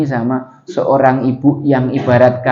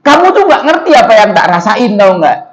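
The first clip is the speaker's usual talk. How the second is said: loud, high angry snapping; angry outburst; 6 semitones higher, 4 dB louder than usual. Acted. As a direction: snap back like a grieving mother in a rage. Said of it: "nyerocos sambil marah". (ibu membentak Rasulullah dengan marah, suara tinggi dan keras)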